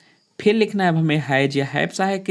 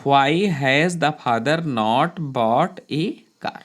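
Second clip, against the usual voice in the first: slow dictation; much slower than usual. Shown as a demonstration. Teacher dictating a sentence.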